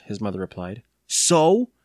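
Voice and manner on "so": put-on defiant boy, higher and louder; unhappy reply. A narrator's reading. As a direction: toss it back like a displeased child. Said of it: "Evan replied unhappily".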